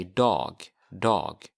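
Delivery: dog said as "dahg" with an open a; teacher says dag twice for learners; Swedish dag. Shown as a demonstration. Teacher drills dag, day, for the class. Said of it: "So to say day, you say".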